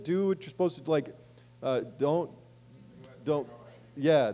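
ordinary delivery

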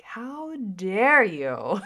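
slow, loud mock outrage. Telling her toddler off, slow, loud mock outrage. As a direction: drag out each word in a hammed-up fury. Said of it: "I'm just like".

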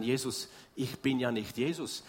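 usual speaking voice

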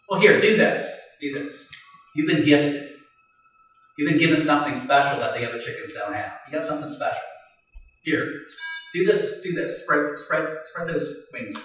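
low, hushed encouragement; soft, patient urging; gentle coaxing. Acted. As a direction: coax them softly, step by step. Old eagle coaxing the young one.

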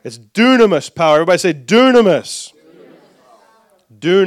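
loud, emphatic preaching; rallying call. Real, not acted. Preacher has everybody say the Greek word, rallying call. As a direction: drive the key word home, loudly. Urging congregation to repeat word.

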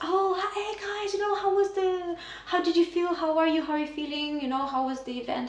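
high-pitched, effusive hello, easing down toward the end; gushing greeting. Retold or imitated. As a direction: imitate an over-friendly, bubbly greeting. Retold gushing greeting at an event.

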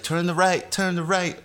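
high, repeating satnav prompt; navigation voice. Pretend. Podcaster planning a navigation tape, mock satnav voice. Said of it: "Navigation, like, tape".

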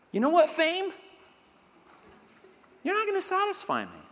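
high-pitched, playful challenge; attitude. Shown as a demonstration. Preacher calling out fame and wine, with attitude. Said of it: "say it with a little attitude".

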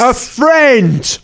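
"friend" set apart in spoken air quotes, high. Acted. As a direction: mark "friend" as a so-called friend. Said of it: "Don't do the air quotes".

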